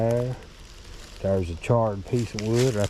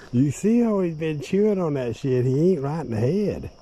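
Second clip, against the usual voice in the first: amused high talk; 8 semitones higher, 5 dB louder than usual. Real, not acted. Amused about the beaver.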